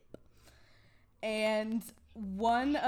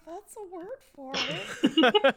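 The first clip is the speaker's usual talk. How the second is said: laughing; 7 semitones higher, 6 dB louder, slower than usual. Retold or imitated. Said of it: "And I'm like".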